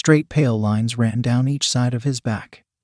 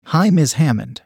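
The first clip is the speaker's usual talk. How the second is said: ordinary delivery, nothing marked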